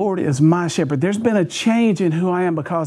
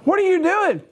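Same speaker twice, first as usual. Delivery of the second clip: high, incredulous "What are you doing?"; 13 semitones higher than usual. Retold or imitated. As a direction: disbelief at the call. Startled by friend's call.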